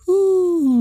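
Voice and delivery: high drawn-out hoot. Shown as a demonstration. Showing a friend how to hoot, high. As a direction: give a high owl-like whoo.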